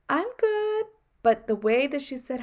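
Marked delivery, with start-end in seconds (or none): 0.1-0.8 much higher, brightly insisting she's fine; chirpy I'm good. Retold or imitated. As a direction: squeak it out like everything's fine. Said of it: "And I'm like".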